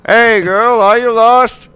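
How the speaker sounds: high, loud shout to get attention. Acted. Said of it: "he shouts".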